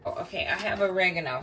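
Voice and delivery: ordinary delivery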